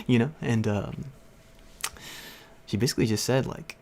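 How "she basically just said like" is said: usual speaking voice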